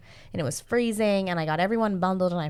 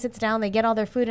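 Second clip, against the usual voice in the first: ordinary talk, nothing marked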